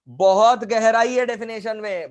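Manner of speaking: "बहुत गहराई" drawn out slowly and loudly; loud, stretched emphasis on "गहराई"; emphatic. Real, not acted. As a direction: lean hard and slow on "बहुत गहराई". Teacher stressing depth against mockers.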